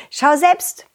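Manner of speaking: plain reading voice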